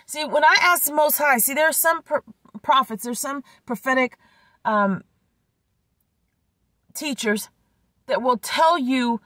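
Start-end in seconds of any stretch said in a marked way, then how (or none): none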